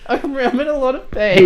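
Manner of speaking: high whiny mock complaint; hammed-up wounded whimper; whiny pained voice. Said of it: "embracing it".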